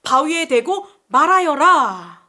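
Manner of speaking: slow, loud, solemn command; stately acted divine order; God's command. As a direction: proclaim it as God instructing Moses, drawn out. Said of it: "모세에게 뭐라고 하셨죠?".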